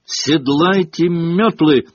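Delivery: ringing referee's order; brisk order. A narrator's reading. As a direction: call the players to mount up. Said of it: "распорядилась мадам Трюк".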